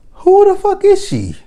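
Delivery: shrieked high and loud; outraged squeal. Acted. Vlogger shrieking in disbelief at the photo shoot.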